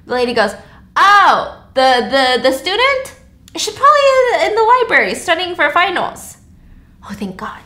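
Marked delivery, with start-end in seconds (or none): 1.0-6.4 higher, louder, breezy matter-of-fact lady; lady neighbor. Acted. Lady neighbor explaining to Jessica, high breezy reassurance. 7.0-7.6 whispered, breathless relief; relieved whisper. Acted. Jessica hearing Taylor is at the library, whispered relief.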